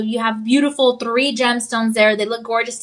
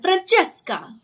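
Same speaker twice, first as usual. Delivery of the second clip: Francesca in a higher, Italian accent, with a rolled, singing vowel on the end; 7 semitones higher, slower than usual. Shown as a demonstration. Host answers a viewer's request, Italian accent. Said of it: "Say Francesca with the accent".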